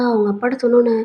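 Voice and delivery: ordinary delivery, nothing marked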